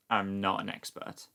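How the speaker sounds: ordinary delivery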